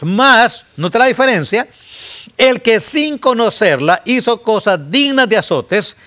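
high, loud warning; full-force preaching. Acted. Preacher warning his congregation, high and loud. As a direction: thunder the warning to the church.

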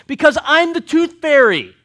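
high, loud proud tooth fairy announcement; mock declaration. Preacher jokingly claims to be the tooth fairy, loud and high. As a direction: proclaim it like a big reveal.

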